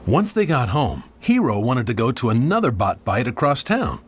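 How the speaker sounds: ordinary delivery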